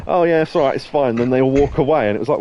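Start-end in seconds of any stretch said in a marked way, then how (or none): 0.1-1.2 relieved thugs. Retold or imitated. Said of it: "And they just go".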